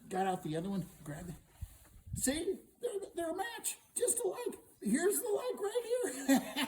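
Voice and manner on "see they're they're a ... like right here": very high, loud, breaking into laughter; gleeful triumph. Acted. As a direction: crow it high and cackle. Hero gleefully matches the two horns.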